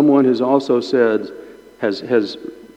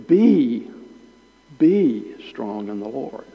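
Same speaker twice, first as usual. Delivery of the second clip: single out Be, pause, then say the line; emphasize Be with a pause after it; stress on Be; slower than usual. Shown as a demonstration. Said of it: "only emphasize the word you've been given".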